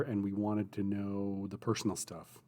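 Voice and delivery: ordinary delivery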